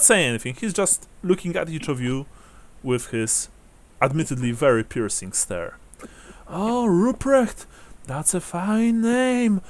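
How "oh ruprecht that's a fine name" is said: put-on high, slow old-lady coo; elderly woman savoring a name; strange old woman. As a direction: draw it out high like an old widow. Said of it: "she says, rather strangely".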